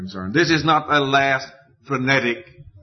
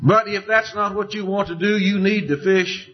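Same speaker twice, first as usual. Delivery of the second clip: higher, challenging ultimatum with "need" lifted; Joshua in modern terms; 9 semitones higher than usual. Retold or imitated. Joshua daring the people to choose, higher and challenging. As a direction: dare them to decide, voice up high. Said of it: "he would say in modern terms".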